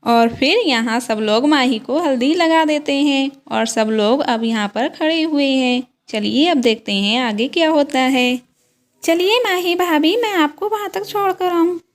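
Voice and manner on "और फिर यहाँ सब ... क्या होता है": lower, even narrator voice; calm narrator telling the doll story; story narration. A narrator's reading. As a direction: step out of the scene and narrate for the viewers. Narrator links the doll scenes.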